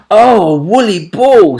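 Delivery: put-on high loud little girl voice; delighted surprise. A narrator's reading. Little girl spotting a woolly ball, high and delighted. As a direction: exclaim with childish delight. Girl sees the bouncing owl.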